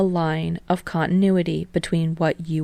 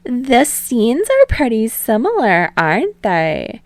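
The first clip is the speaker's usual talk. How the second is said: higher, brighter host voice, louder, slightly slower; playful TV host calming the boys, then prying; teasing talk show host; 6 semitones higher, 5 dB louder than usual. Acted. Host Utsushimi probing.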